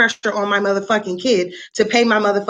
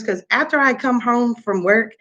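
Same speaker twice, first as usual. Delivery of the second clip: normal talking voice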